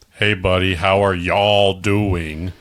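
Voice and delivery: slow put-on Southern accent; a badly faked Southern greeting; fake Southern. Outsider faking the Southern greeting, slow put-on Southern. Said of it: "you don't sound right".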